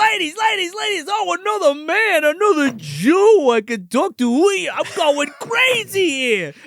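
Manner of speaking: high, loud, gushing greeting; a hammed-up gushing "ladies, ladies"; excited pushy neighbor. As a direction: ham up an overeager neighbor. Alan Blumenfield voiced, excited greeting.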